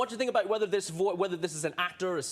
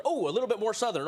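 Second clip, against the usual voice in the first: ordinary delivery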